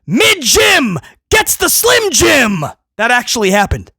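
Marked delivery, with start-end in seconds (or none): 0.0-2.6 high, slow, shouted commentator yell; hammed-up shouting commentator; mock Corey Graves scream. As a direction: yell it like a hyped announcer. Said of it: "Corey Graves screamed".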